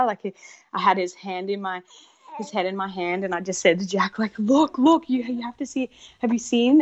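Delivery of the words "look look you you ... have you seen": higher, louder, eager calling out; bursting with excitement, come look; excited urging. Retold or imitated. Urging Jack to see the baby's head, excited.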